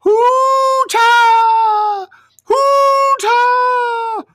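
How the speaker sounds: high, loud calling cry; shepherd calling his sheep from afar; shepherd's call. Acted. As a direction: call out over the flock. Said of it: "the soothing cry".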